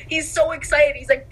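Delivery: ordinary talk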